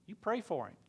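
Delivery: urgent repeated entreaty; pressing harder. Retold or imitated. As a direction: push the demand up a notch. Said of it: "He says".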